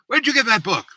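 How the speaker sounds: high, loud startled accusation; high-pitched mock outburst of a young woman; girl's accusing cry. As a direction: demand it back as if it's yours. Girl confronts him over book.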